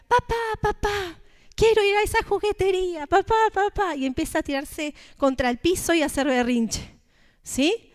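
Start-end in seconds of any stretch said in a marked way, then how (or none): 0.1-1.2 put-on high whiny child calling his dad; a small boy begging his father; pleading child. Little boy begging dad outside the toy shop, high and whiny. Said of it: "Y el niño empieza". 1.5-3.9 put-on pleading child. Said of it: "Y el niño empieza".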